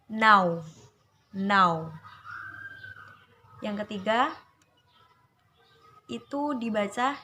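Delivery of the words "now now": "now" with a loud, clear au glide; clear au diphthong. Shown as a demonstration. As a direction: open wide into the au of "now". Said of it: "Contoh dalam kata". (ucapkan "now" dengan bunyi au yang jelas)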